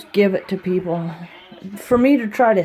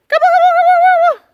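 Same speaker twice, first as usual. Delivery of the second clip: much higher, cooing voice for the dogs; doggy talk; 8 dB louder, much faster than usual. Acted. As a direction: coo at the dogs like babies. Calling animals.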